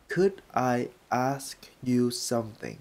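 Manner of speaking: slow, careful model question; slow demo. Shown as a demonstration. Teacher modelling a polite question slowly. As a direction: spell it out slowly. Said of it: "Bạn có thể cho tôi hỏi không".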